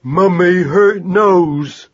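put-on slow, high, loud baby wail; plaintive. A narrator's reading. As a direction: complain like a hurt toddler. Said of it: "hear Magnus saying plaintively".

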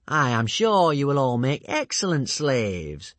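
sugary menace, slow and loud; sweet-talking villain, lightly voiced; mock-kind villain. A narrator's reading. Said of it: "said Alvin kindly".

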